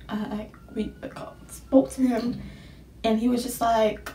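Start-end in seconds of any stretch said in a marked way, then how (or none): 0.1-2.4 whisper. Real, not acted. Hesitant admission.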